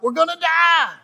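high, loud panicked yell; terrified shout of the disciples; panicked cry. Retold or imitated. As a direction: cry out like men about to drown.

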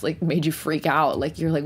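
ordinary talk

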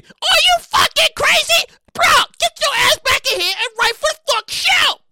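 high, loud incredulous yell; outraged shout. Pretend. Host self shouting at writer alter ego. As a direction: shriek in disbelief. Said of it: "the two alter egos".

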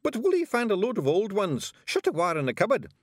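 high, loud, cheery report; chirpy pixie voice; cheerful. A narrator's reading. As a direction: announce the find cheerfully, pitched high. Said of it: "said Rob cheerfully".

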